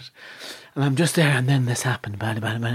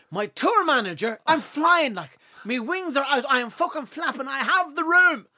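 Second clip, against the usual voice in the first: ordinary delivery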